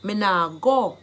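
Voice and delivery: Jamaican Patois. Shown as a demonstration. Teacher translating a refusal for learners, in Jamaican Patois. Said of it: "I've given you the Jamaican dialect".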